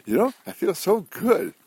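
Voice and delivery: gushing joy. Retold or imitated. Ellen tells the congregation how good she feels, gushing high. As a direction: burst out with bright happiness, up high. Ellen voiced feeling uplifted.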